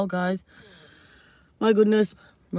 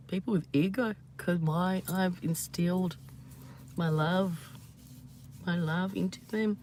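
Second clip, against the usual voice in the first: soft voice; 8 dB quieter than usual. Real, not acted. Tender aside about love.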